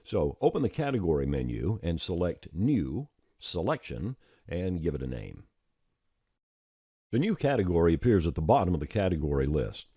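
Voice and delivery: ordinary delivery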